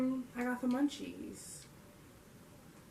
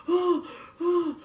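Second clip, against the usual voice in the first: mock panicked gasps; 6 semitones higher than usual. Retells panic while throwing up.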